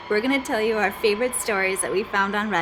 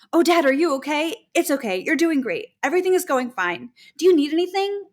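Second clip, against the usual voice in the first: higher-pitched imitation of a solicitous nurse; concerned reassurance; 6 semitones higher than usual. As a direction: voice a nurse checking on an anxious dad. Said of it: "Thinking he's emotional and about to lose it".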